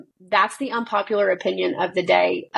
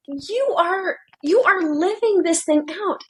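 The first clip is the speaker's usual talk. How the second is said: excited revelation; 9 semitones higher than usual. Retold or imitated. Mother telling her daughter that God speaks to her, thrilled and high. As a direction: burst out with joy at the discovery.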